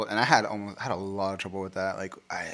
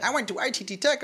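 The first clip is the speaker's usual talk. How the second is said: much higher mock ad testimonial; high-pitched send-up of a TV testimonial; trade-school commercial spokesman; 13 semitones higher than usual. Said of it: "the problem with the, the trade schools is? The commercials".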